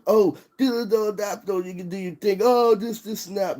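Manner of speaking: high, sing-song mock of himself while high; mock dreamy voice. Ranting man mocks his own stoned thoughts in a high sing-song voice. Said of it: "So I'm all like".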